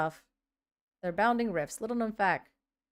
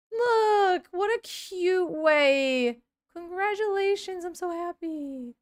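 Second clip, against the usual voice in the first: high, drawn-out coo; tender gush; 8 semitones higher, much slower than usual. Real, not acted. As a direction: coo over the happy news. Gushing over baby announcement.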